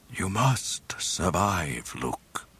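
slow ghostly whisper with quiet command; gentle authority. Acted. Ben's spirit urging the dying Luke, whispered. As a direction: breathe it gently but firmly. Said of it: "the apparition said with gentle authority".

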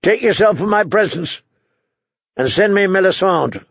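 high, insistent royal command; imperious order. Acted. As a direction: order him out of your sight. King orders knight out.

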